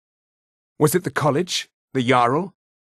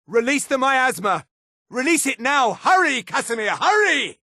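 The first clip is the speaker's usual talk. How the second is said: high, frantic order called out; urgent command; 7 semitones higher than usual. Acted. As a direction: cry out to hurry an ally on. Urgent order in game scene.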